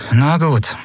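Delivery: high-pitched, sulky giving in; grudging, sour agreement; sour concession. A narrator's reading. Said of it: "lenkte Lien säuerlich ein".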